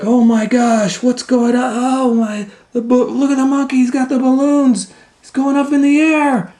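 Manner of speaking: very high, fast, flustered voice; panicked balloon man. Acted. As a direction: babble in alarm at the flying monkey. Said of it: "the balloon man was like".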